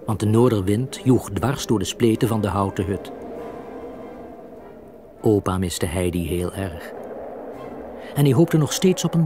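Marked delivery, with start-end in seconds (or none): none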